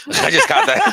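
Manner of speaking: laughing at himself; high laugh through the words; amused self-mocking laugh. Real, not acted. Laughs at realizing he's old.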